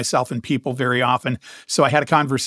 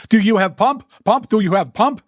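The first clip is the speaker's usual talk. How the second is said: put-on old German lady's thick accent, pitched up and punched; heavy German accent; 7 semitones higher, 4 dB louder than usual. Old German lady accosting a stranger with a bike, heavy German accent. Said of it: "got this heavy accent".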